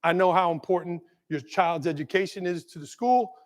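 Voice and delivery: a touch of sincere apology; gentle apology letter. Retold or imitated. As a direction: read the apology kindly. Letter to parent read out.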